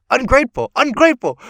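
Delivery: a high, hammed-up angry repeat; mock furious echo. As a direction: spit the word back at her. Danny furious, echoing her mother.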